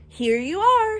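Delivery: high, loud and strange call; mysterious voice. A narrator's reading. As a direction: announce it like a hidden guide. Mysterious voice announces arrival.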